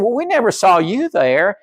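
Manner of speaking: high, puzzled protest; puzzled sheep. Retold or imitated. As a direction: wonder aloud, baffled. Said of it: "And they said".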